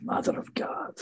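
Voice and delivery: hushed, secretive echo of the line; whispered. Retold or imitated. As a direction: whisper the catchphrase under the breath.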